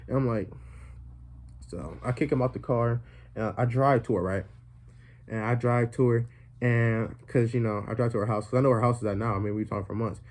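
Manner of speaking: normal talking voice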